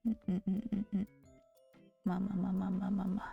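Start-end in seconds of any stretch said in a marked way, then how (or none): none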